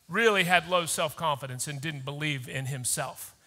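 usual speaking voice

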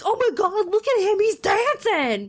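put-on high-pitched, gleeful childlike amazement; giddy kid spotting something wonderful; excited like a child. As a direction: squeal it like a thrilled kid, drawing out the last words. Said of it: "I was like a child".

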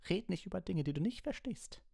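very high, strained outburst; distraught, high-pitched fury; shrieking anger. A narrator's reading. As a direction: screech it at the pupil. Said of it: "schrie Snape".

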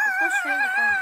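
very high squeaky repeat; high squeal. Pretend. Repeats line, second take squealed.

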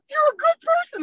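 high wailing mimicry of a sobbing woman; imitated crying. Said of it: "she cried so hard".

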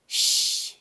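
sharp unvoiced shush, copying; mock them with a shush; shushing back. A narrator's reading. Toddler shushing the audience right back, sharp. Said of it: "Fudge said back to them".

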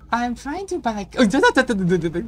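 rising panic, stuck on da da da; frantic flustered stammer. Acted. Voiced character stammering, flustered.

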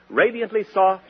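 ordinary talk

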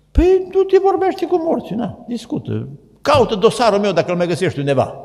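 high, loud, cocky reply; mock indignant retort. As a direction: throw it back like a smug sinner. Man retorts in a quoted exchange. (replică obraznică, ridicată și tare)

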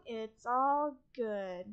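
usual speaking voice

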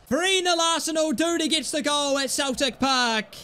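ordinary talk, nothing marked

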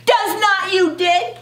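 high-pitched contradiction; insistent kid's comeback; quarrelling. A narrator's reading. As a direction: fire it straight back at a sister. Acted sibling back-and-forth quarrel.

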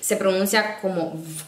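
normal talking voice

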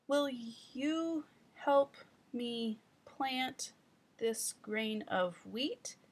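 put-on slow, careful child reading aloud; child rereading. Shown as a demonstration. Teacher playing the child's correct second try, slow and careful. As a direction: read it through slowly, now getting it right. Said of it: "I will have to be both the child".

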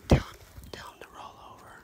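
hushed quick prompt; whispered coaching. Real, not acted. Dad whispering instructions to his son, fast.

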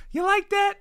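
very high yelled taunt; Kirk Cousins impression. Streamer imitating a quarterback's celebration, very high. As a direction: squeal it high and proud. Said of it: "Kirk Cousins say".

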